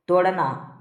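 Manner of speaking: ordinary talk, nothing marked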